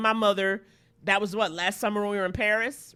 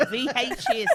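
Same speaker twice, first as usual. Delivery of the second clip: put-on Australian accent through a laugh; 3 semitones higher than usual. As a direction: giggle it out in mock Australian. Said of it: "an Australian accent when they s".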